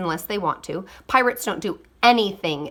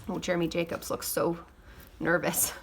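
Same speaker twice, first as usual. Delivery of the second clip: soft aside; 2 semitones lower, 9 dB quieter than usual. Real, not acted. Aside to child listener.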